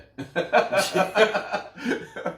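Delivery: a burst of high-pitched laughter. Real, not acted. Host laughs after quoting the player. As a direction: laugh at your own punchline.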